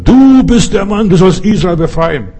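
ringing summons, voice lifted high; commanding call. Acted. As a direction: call out a commission with full force. God's commanding call to Gideon.

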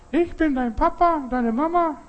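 very high put-on voice; mock the voice-faker, squeaky; imitated fake voice. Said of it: "nur eine nachgemachte Stimme". (nachgemachte, sehr hohe Stimme)

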